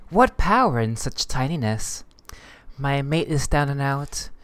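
ordinary talk, nothing marked

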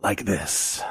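sinking into a low, soft register. Shown as a demonstration. Showing how to do Walken, sinking low. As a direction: drop the voice down deep and quiet. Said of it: "you have to get down here".

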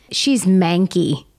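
slow, disgusted "She's manky". Shown as a demonstration. Friend demonstrating Irish slang, slow and disgusted. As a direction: sneer it out slowly with a disgusted face. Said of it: "just by the look on your face".

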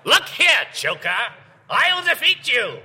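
comic screeching Batman menacing the Joker; Gottfried Batman. Pretend. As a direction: squawk the threat at the villain. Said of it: "He could be like".

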